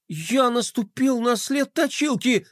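high, slow horrified wail; panicking. A narrator's reading. Boy who stepped on unlucky tracks, panicking. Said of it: "Флай сразу запаниковал".

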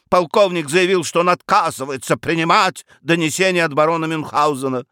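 put-on high, aggrieved young man's tirade; agitated complaint. A narrator's reading. Son blaming his father for his failed career, agitated. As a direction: pour out your grievances, pitch held high. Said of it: "сжимая кулаки".

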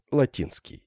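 plain reading voice

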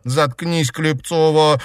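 through gritted teeth. A narrator's reading. Enraged girl silencing a classmate, through gritted teeth. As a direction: force it out between clenched teeth. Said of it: "процедила сквозь зубы Наташа".

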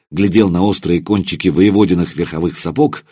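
ordinary delivery

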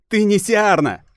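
high, loud defiant cry; defiant shout. Acted. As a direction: hurl the denial at her. Priestess defies goddess. (дерзкий выкрик)

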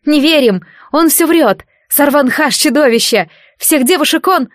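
high, loud crying out; desperate shout. A narrator's reading. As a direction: yell a warning in despair. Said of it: "раздался крик". (отчаянный крик)

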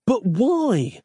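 high-pitched, dismayed plea; high, exasperated bewilderment; bewildered protest. A narrator's reading. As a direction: beg for an explanation, voice shooting up. Nick pleads for a reason.

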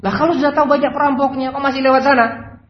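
high, loud, fast scolding; acted exasperated tirade; angrier boss. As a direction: rattle off the accusation in outrage. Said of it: "Tambah marah dia".

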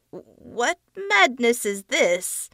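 nervous creature voice. A narrator's reading. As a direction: sound rattled by the mirrors. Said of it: "the face said nervously".

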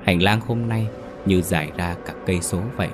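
ordinary delivery

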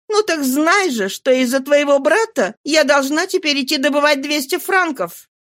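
high-pitched woman complaining with scorn; Nana, aggrieved. Acted. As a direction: vent resentment about his brother.

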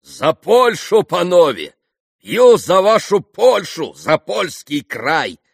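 loud, high, drawn-out toast; exclaimed toast. A narrator's reading. Mitya toasting Poland to the guests, exclaiming. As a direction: proclaim it to the table, slowly and loudly. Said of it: "воскликнул Митя".

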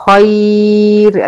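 Quranic recitation of خير with a light final ر; recited pausal form. Shown as a demonstration. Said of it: "زي كلمة خير عند الوقف".

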